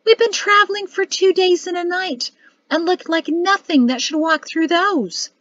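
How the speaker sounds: high-pitched, dismayed complaint; wailing. A narrator's reading. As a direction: wail in dismay about the state you are in. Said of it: "she wailed in a whisper".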